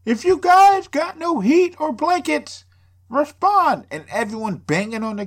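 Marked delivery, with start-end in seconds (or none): 0.0-3.9 high, loud, slow call through a megaphone. Retold or imitated. Protester calling to the inmates over a megaphone, high loud slow. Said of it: "megaphone shit?".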